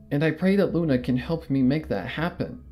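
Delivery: ordinary delivery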